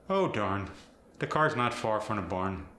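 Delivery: Fargo accent with hard R; Minnesotan, lean hard on the R. Shown as a demonstration. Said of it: "the R becomes harder".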